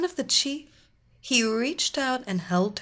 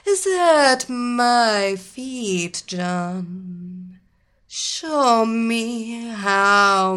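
slow, imperious queen voice; evil queen; 5 dB louder, much slower than usual. Acted. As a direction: demand worship, drawn out. Queen commands kneeling policeman.

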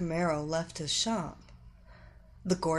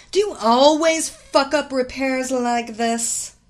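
loud, high, scolding demand; harsh raised-voice telling-off; angry bark; 5 semitones higher, 10 dB louder than usual. A narrator's reading. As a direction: bark at the incompetent mechanic. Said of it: "Evelina barked, her voice neither soft nor sweet".

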